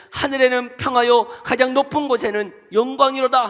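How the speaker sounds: loud and high; preacher voicing the crowd's loud praise; proclaimed praise. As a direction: call it out like the crowd's acclaim.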